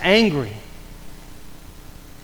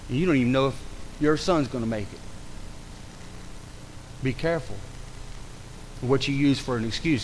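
very low, soft and solemn; hushed preacher's caution, heavy pauses; grave warning; 8 semitones lower, 11 dB quieter than usual. Real, not acted. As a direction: bring the voice right down for a sober warning.

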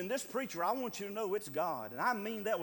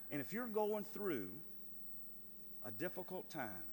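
soft, low, tender; almost whisper it to the hurting; hushed reassurance; 7 semitones lower, 10 dB quieter than usual. Acted. Soothing the hurting congregation.

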